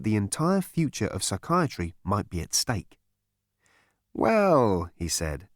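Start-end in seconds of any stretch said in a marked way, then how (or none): 4.1-4.9 smooth psychiatrist. A narrator's reading. Psychiatrist greets Vogon.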